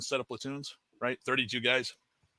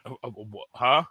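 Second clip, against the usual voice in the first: mumbled stunned huh; stunned confusion. Retold or imitated. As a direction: go blank with shock.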